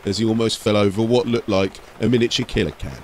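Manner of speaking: steady storyteller read of an ork tale; story narration. A narrator's reading. Narrator telling an ork story to listeners, storytelling voice. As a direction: carry the listener through the scene.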